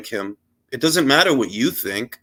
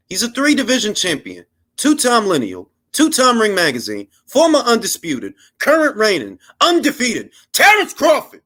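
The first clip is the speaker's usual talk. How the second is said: ring announcer; 8 semitones higher than usual. Acted. Announcer-style title list.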